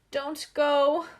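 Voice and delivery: high, loud anguished call; anguished cry. A narrator's reading. Gus calls from the window as the Scotts leave, high anguished cry. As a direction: cry after them from the attic. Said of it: "cried Gus in anguish".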